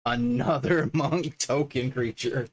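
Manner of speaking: amused, breaking into laughter; laughing. Real, not acted. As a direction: laugh as you read it out. Amused at yet another token.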